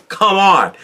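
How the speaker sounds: usual speaking voice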